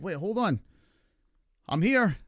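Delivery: mock protest. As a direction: call out to be noticed. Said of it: "I was just like".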